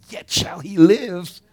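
slow, high, full-voiced shout; triumphant proclamation. Real, not acted. Preacher declaring resurrection to the congregation, triumphant shout. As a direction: belt it out as a victorious climax. Preacher's climax of quoted scripture.

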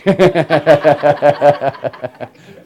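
big loud burst of laughter. Real, not acted. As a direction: laugh hard at the punchline. Laughs at his own punchline.

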